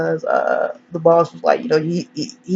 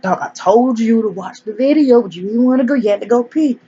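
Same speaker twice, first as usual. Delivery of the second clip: mock scolding; 5 semitones higher than usual. Boss scolds him at gunpoint.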